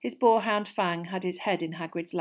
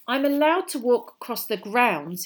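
higher, louder pointed remark; 5 semitones higher, 5 dB louder than usual. A narrator's reading. Harry answers Hermione's glance, pointed. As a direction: make the point firmly, slightly raised. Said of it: "he said pointedly".

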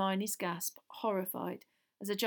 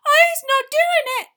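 very high, loud squeal of terror; squealing elf; 18 dB louder than usual. A narrator's reading. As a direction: squeal it as a terrified little elf. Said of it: "she squealed".

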